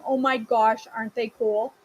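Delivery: normal talking voice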